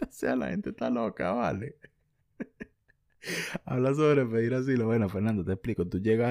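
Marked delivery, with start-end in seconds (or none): 0.0-4.9 chuckling while rereading the question; laughing. Real, not acted. Answering Fernando's odd question, laughing incredulously. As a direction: laugh in disbelief at the request.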